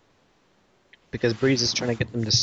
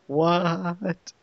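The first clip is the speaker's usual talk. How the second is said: long, higher-pitched "what"; drawn-out disbelief; 6 semitones higher than usual. Acted. Reviewer still baffled that Evo got the credit, drawn-out incredulous. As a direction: stretch it out in baffled mock disbelief.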